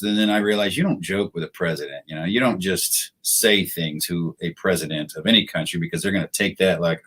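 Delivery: ordinary talk, nothing marked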